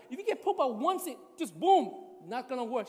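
a husband pleading his case, worked up; heated protest. Retold or imitated. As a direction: insist it won't work, getting worked up. Arguing with wife about drops.

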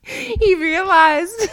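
high, drawn-out, through laughter; helpless laughing squeal. Real, not acted. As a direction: crack up while telling it. Cracking up at her own story.

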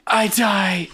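hammed-up, high dying cry; mock death. Co-host interrupts the recap to play dying, high and hammed-up. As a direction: fake a melodramatic death.

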